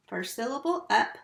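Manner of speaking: ordinary talk, nothing marked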